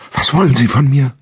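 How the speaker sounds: high, loud frightened outburst; blurted in fear. Acted. Captive woman to unseen stranger, high loud frightened blurt. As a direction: spit it out in sudden panic. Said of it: "stieß sie hervor".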